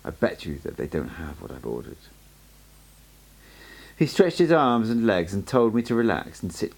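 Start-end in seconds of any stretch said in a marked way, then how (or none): 0.0-2.0 hushed and low, close to the ear; a soft near-whisper, barely read out. A narrator's reading. Don Juan leans in to tell the narrator, hushed. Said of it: "Don Juan whispered in my ear".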